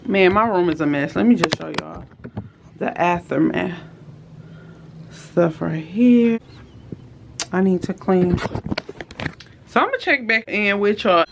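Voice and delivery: usual speaking voice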